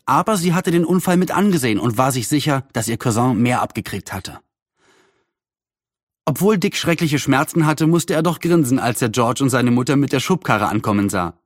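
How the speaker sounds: usual speaking voice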